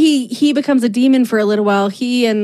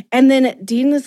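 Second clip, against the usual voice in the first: ordinary talk, nothing marked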